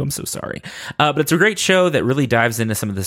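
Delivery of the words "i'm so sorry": whispered apology. Real, not acted. Apologizing for butchering accents.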